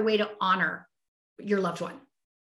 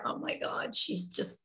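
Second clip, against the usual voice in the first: whispered husband quote; 11 dB quieter than usual. Retold or imitated. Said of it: "He talks to me and he's like".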